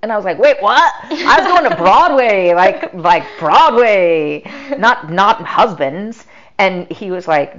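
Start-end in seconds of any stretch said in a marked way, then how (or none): 0.4-6.2 playful disbelief, laughing through; mock outrage. Shock at God's plan.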